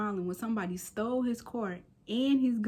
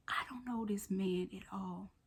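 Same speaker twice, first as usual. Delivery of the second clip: hushed whisper to the camera; whispered aside; 9 dB quieter than usual. Real, not acted. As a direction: drop to a secret whisper.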